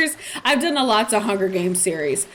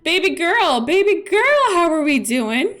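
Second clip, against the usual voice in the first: higher, louder cooing call; calling out sweetly; 6 semitones higher, 3 dB louder than usual. Real, not acted. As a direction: call her like a pet. Calling to a game character.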